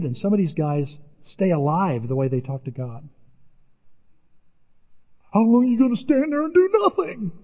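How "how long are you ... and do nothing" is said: indignant complaint. Retold or imitated. Psalmist complaining to God.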